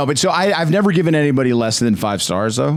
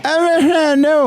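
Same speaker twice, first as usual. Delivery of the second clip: incredulous no; 4 dB louder than usual. Real, not acted. Reacting to the driver's text.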